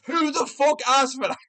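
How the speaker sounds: laughs through the scoff; laughing disbelief. Real, not acted. Laughing at Arman's side quest, amused scoff. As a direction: chuckle at how absurd it is.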